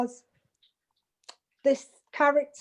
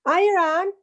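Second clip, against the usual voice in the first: higher, louder greeting as if from the car; phone shout; 6 semitones higher, 5 dB louder than usual. Retold or imitated. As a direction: call it down the phone with a lift. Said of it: "shouts down the phone because he's in the car".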